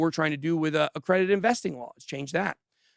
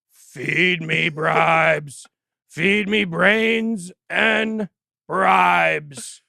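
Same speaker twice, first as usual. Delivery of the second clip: slow, groaning undead voice; slow, louder zombie moan; 2 semitones higher, 7 dB louder, much slower than usual. Pretend. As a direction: moan like a bribe-hungry zombie. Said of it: "I'm a zombie".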